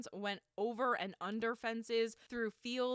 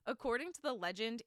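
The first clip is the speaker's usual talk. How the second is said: ordinary delivery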